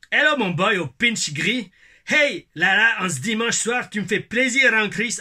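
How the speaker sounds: loud, slow Québécois greeting; Québécois accent. Pretend. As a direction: hail him like a Québécois buddy. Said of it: "mon accent québécois". (accent québécois fort et enjoué)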